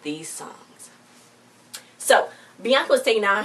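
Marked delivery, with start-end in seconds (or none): 0.0-0.9 trailing off into a whisper. Real, not acted. Telling viewers how hard DJs work, in a whisper. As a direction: drop to a breathy whisper at the end. Aside about DJ stress.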